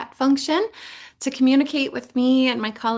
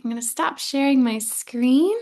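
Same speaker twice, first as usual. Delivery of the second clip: ordinary delivery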